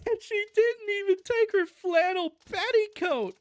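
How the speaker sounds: very high, loud wail; lamenting. A narrator's reading. Cousin fretting over flannel petticoat, lamenting wail. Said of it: "lamented Cousin Stickles".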